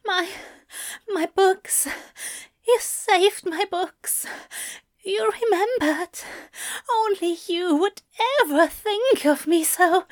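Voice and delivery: high, halting lover's wonder; keen it high, pausing between phrases; keening with pleasure. A narrator's reading. Aziraphale to rescuer of the books, keening. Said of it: "Aziraphale keens with pleasure".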